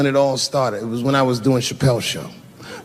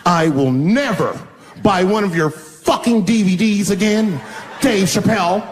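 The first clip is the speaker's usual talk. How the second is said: indignant parting shot, pitched up; imitated upset woman; 5 semitones higher than usual. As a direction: act out her angry dig on the way out. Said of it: "She got very upset".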